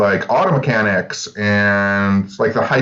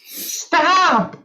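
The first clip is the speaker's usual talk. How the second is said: tiny high squeaky stop; squeaky car voice. Pretend. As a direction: squeak like a brake. Car voice bit again.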